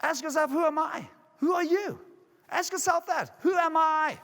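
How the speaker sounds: high-pitched motivational exhortation; rousing challenge. Real, not acted. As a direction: press the audience to question themselves. Urges audience with rhetorical challenge.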